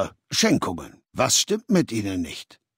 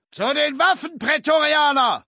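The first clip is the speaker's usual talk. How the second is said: commanding shout. Acted. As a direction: summon the guards with a sharp high call. Caesar ruft Wachen herbei. (lauter Befehlsruf an die Prätorianer)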